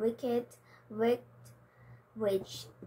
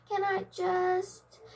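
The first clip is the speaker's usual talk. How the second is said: hesitant start; 8 semitones higher, slower than usual. Acted. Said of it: "began the tortoise".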